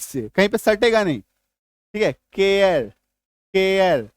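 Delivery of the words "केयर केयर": केयर said with the mouth kept open; open-mouth vowel. Shown as a demonstration. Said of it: "मुंह खुला ही हुआ है देखो".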